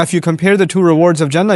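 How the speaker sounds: normal talking voice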